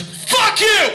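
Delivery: high, loud outburst; acted furious yell; angry shout. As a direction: explode at them as the hostile stranger. Acted threat from angry stranger.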